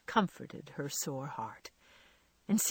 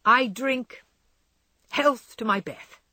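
brave toast; 5 semitones higher, 6 dB louder than usual. Acted. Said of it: "said bravely".

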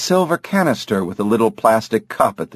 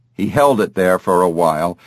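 ordinary delivery

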